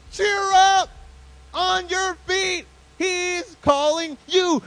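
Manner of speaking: high, loud, joyful holler; rousing shout to someone far off; yelling. Shown as a demonstration. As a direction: yell it with long, stretched words. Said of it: "That means that they are yelling this".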